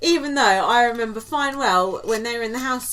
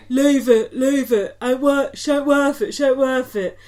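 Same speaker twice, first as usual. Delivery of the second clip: loud, rough-and-ready "leave it" impression; hammed-up bystander stepping into a fight; mock peacemaker; 2 semitones higher, 5 dB louder than usual. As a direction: break up a row, loud and insistent. Voicing Danny stepping in to argument.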